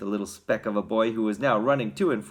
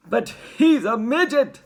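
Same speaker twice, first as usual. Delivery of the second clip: high, loud, drawn-out shout; appalled father's shout; 11 semitones higher, 8 dB louder than usual. A narrator's reading. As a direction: shout it in dismay. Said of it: "shouted Mister Teavee".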